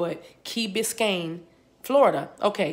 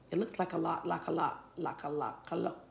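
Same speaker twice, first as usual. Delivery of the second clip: soft, trailing repeats that get quieter; fading murmur; 10 dB quieter than usual. Real, not acted. Channeling a vision, murmuring more softly each time. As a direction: let it drift away to almost nothing.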